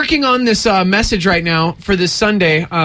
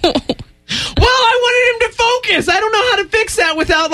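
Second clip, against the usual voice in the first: excited. Real, not acted. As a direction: blurt it out in a high voice. Defensive outburst about son.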